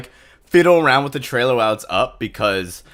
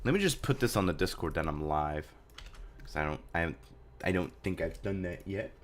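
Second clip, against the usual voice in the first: quiet aside; 7 semitones lower, 12 dB quieter than usual. Real, not acted. Muttering aside while typing on Discord.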